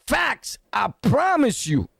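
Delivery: normal talking voice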